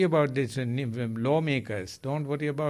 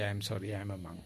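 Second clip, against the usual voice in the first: soft polite refusal; 6 semitones lower, 8 dB quieter, much faster than usual. Pretend. Monk politely declining a request.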